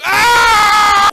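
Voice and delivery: very high, very loud sung scream; over-the-top shrieking note; belted scream. Acted. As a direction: belt a huge high note.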